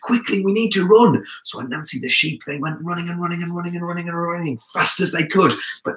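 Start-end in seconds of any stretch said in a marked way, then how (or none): 0.0-1.2 high, loud, urgent cry; urgent warning. A narrator's reading. Anansi warns the sheep of the farmer, high and urgent. 2.5-5.5 high, fast, repetitive rush; breakneck narration. A narrator's reading. Narrator tells the escape, high and hurried. Narrator mimics the frantic running.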